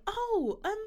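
high-pitched imitation of a puzzled questioner; baffled male coworker. Imitating a coworker puzzled by her hair, baffled. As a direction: raise the pitch for his naive question. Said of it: "he was like".

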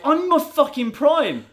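high and loud, stung protest; indignant outburst. Retold or imitated. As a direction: fire back offended. Sean angrily insisting.

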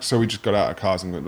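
ordinary talk

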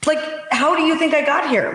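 high-pitched, disbelieving comeback; incredulous retort. Retold or imitated. Senator retelling her retort to a lobbyist, high and incredulous. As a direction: fire it back at him, stunned he'd ask.

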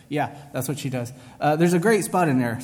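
usual speaking voice

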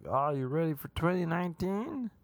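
put-on excited driver in a wobbly Swedish-American accent; over-excited Uber driver. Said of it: "That was kind of his voice".

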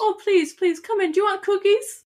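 put-on high, eager old gnome woman; sweet squeaky granny; little old grandma voice.

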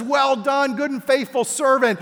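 mildly hammed-up high, proud praise; master's praise. Master praising his faithful servant, high and approving. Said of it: "He says".

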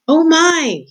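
sudden high "Oh my!"; a startled gasp of words; startled cry. A narrator's reading. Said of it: "he said out loud".

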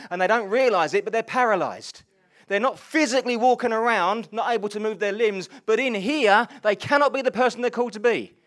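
loud, high, driving preacher's call; rally the congregation with rising fervour; fervent sermon climax, slowing on key lines; preaching at full force. Acted. Preaching climax on being freed.

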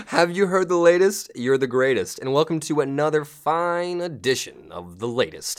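hammed-up show opener; podcast host intro. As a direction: announce the show and guest with big energy.

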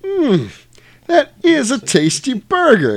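high, slow, loud mock savouring; imitating Jules. Jules tastes the burger, slow, high and savouring. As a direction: relish it, stretched and high. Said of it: "He's like".

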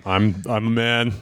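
slow, low dumb caveman. Pretend. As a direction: grunt it like a proud brute. Caveman-like boast about the heckler.

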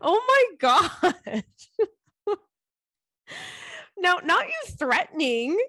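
high-pitched, laughing disbelief; amused shock. Real, not acted. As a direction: react in delighted mock horror, voice way up. Amused shock at listener's playful threat.

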